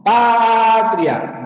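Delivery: "Patria" as PAAA-tria, high and loud first syllable; stress on PA. Shown as a demonstration. Teacher showing class a diphthong, drawn-out PA in "Patria". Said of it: "Si la pronunciación fuera patria".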